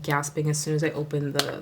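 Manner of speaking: ordinary talk, nothing marked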